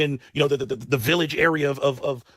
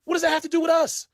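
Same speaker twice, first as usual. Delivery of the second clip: much higher, incredulous shout-question; what the hell, nothing to do with us; exasperated outburst; 12 semitones higher than usual. Retold or imitated. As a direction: blow up in disbelief. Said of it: "I'm like".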